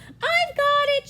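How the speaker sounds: high, loud, stretched claim; excited shout. Acted. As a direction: call out a triumphant catch. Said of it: "shouted Hedgehog".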